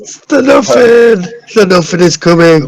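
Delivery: high alarmed cry; hammed-up doom warning; mock panic. Mock alarm about the Nothing.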